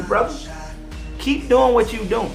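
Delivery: ordinary talk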